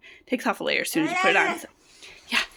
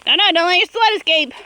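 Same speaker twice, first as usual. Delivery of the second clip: calling out; 7 semitones higher, 7 dB louder than usual. Real, not acted. Calling to kid's runaway sled.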